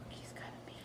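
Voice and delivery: hushed storybook whisper. Shown as a demonstration. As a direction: whisper it like a secret. Storytelling aside.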